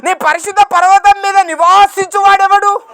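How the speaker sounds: loud, high, ringing proclamation of scripture; preaching at full force. Acted. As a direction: cry the verse out to the congregation. (గట్టిగా ప్రసంగ స్వరం)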